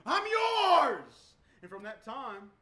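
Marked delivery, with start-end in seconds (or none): 0.0-1.1 high, loud cry of surrender; crying out to God. Retold or imitated. Driver crying out to God in the rain, high and loud. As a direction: call out, giving yourself up. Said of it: "I cried out to God and I said".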